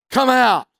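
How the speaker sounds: sharp, loud command at the demon; a preacher voicing Christ's command; commanding shout. A narrator's reading. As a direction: order the demon out, full voice. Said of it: "says to the demon".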